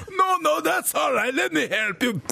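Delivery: put-on squeaky high little old lady protesting politely. Old lady on the train politely refusing help, high squeak. Man insists, same high voice.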